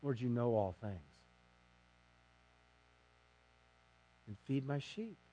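soft, wounded reply to Jesus; hurt answer. Acted. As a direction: answer as if the question stings. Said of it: "And Peter's hurt".

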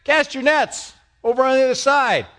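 high call from the beach; a man on shore hailing fishermen. Retold or imitated. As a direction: shout across the water to the boat. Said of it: "this guy goes".

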